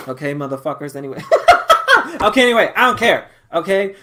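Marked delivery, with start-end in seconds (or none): 1.3-4.0 loud, high, after a burst of laughter; laughing it off. Real, not acted. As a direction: brush it off loudly through laughter. Laughs off her own blaming.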